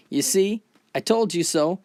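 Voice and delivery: angry yell. Acted. Drunk salesman berating his smaller companion, acted angry yell. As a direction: yell it as a told-you-so rant. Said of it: "and yells".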